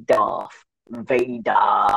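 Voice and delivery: slow, stretched-out "Darth Vader"; drawn-out movie line. Retold or imitated. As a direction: draw both words right out, imitating the film. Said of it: "I really like how he says".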